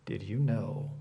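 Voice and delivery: hushed, low, confiding teaser; conspiratorial gossip, with teasing pauses; gossip intonation. Shown as a demonstration. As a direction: drop low and soft, leaking a juicy secret. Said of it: "There's always this intonation like".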